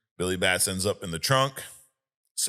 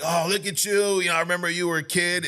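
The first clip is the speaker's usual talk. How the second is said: much higher, gushing retold hello; high-pitched mock-delighted greeting; excited greeting; 10 semitones higher than usual. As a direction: imitate a guy delighted to spot someone he knows. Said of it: "He's all excited".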